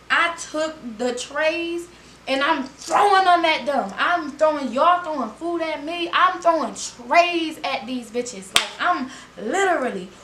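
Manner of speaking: high, loud and worked up; loud keyed-up retelling; excited. Real, not acted. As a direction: relive the tray-throwing frenzy. Climax of fight retelling.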